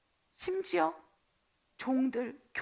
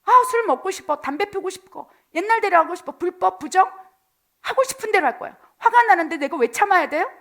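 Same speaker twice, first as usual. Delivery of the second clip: craving; 5 semitones higher, 8 dB louder than usual. Acted. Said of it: "사탄이 주는 유익을 받고 싶어요".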